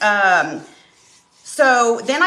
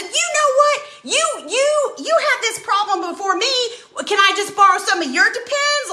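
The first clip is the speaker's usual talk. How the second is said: much higher, sassy comeback; mock outrage; 12 semitones higher than usual. Snapping back at her husband about his Depends, mock outrage. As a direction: fire back with playful indignation.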